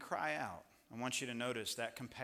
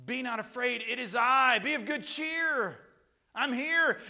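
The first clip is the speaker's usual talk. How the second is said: loud, high proclamation; preaching loud; 12 semitones higher, 13 dB louder, slower than usual. Acted. Preacher proclaiming God's words to the congregation, loud. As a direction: proclaim it to the whole room.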